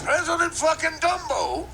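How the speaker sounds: high, drawn-out mock outrage; hammed-up incredulous jeer; jeering onlooker. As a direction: holler it slowly in a put-on high voice. Same acted onlooker jeers.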